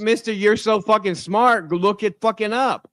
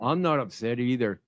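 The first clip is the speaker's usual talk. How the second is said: softer, lower-pitched denial; 9 semitones lower, 9 dB quieter than usual. Real, not acted. Man denying he is upset mid-argument, voice lowered. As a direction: bring the voice down, low and soft. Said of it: "let me lower my voice a little bit".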